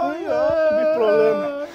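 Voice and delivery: lloriqueo fingido, agudo y alargado; llanto imitado. Retold or imitated. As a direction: imita a alguien que llega quejándose de su problema. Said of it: "vino llorando". (llanto de parodia)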